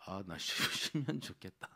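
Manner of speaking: confession cracked up by a laugh; real laugh interrupting the words; breaking into laughter. Real, not acted. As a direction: start wistfully, then lose it laughing. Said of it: "웃어가지고".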